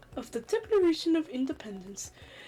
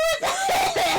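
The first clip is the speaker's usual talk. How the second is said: laughing; 5 dB louder than usual. Real, not acted. Bursts out laughing.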